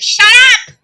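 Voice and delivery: high, loud mock outburst; sudden exasperated yell, acted out; angry shout. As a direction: imitate him snapping at noisy people. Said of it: "he was, like, angry with us".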